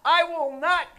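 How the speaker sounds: high, loud preaching shout; emphatic divine declaration. Acted. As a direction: declare it forcefully as God's word. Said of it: "God says".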